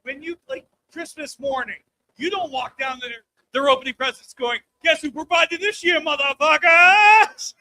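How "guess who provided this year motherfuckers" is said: loud, slow gloat ending in a yell; mock gloating shout. Parent gloating at the kids on Christmas morning, slow mock yell. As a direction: brag at the kids, dragging out the last word.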